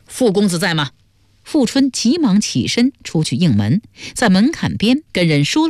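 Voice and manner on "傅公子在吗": plain reading voice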